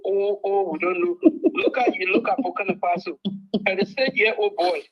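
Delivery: normal talking voice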